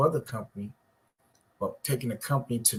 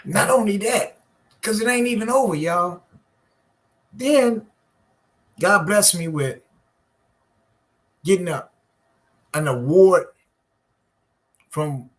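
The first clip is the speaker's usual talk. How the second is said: preaching; 8 semitones higher, 7 dB louder than usual. Acted. Preaching at full force to viewers.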